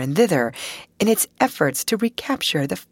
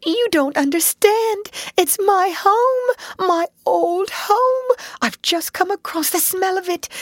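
anguished pleading; 11 semitones higher than usual. Acted. Mole begging Ratty to understand, high and near tears. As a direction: plead with breaking heart. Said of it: "in anguish of heart".

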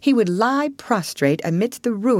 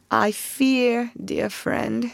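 sad smile; 6 semitones higher, much slower than usual. Acted. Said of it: "said Toad with a sad smile".

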